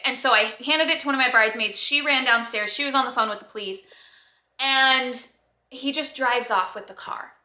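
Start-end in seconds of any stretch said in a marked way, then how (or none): none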